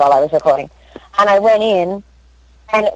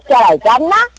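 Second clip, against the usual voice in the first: mock Australian mixed with English, pitched up; bad American-Australian accent; 5 semitones higher than usual. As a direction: botch an Aussie accent on purpose. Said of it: "horrible American Australian accents".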